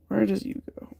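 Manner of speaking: breathy whispered "Where does you go?"; soft unvoiced whisper of the question. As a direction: whisper the silly grammar to yourself. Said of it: "totally improper English".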